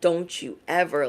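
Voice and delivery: muttered mom-style rebuke; self-scolding mom voice. Retold or imitated. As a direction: scold yourself under your breath. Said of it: "I'm like".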